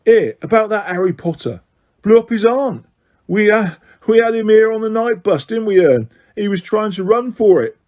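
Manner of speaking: a lively London lad, chatty; Stan's voice. A narrator's reading. Stan imagined gossiping.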